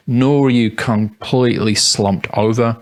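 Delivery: ordinary talk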